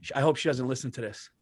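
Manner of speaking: hushed confiding. Real, not acted. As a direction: keep it low so the wife won't hear. Hiding plan from listening wife.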